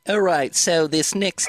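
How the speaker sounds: put-on teasing a Kiwi singer's on-stage accent; mock New Zealand accent. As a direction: announce the next song in a hint of a Kiwi accent. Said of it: "'cause of your accent".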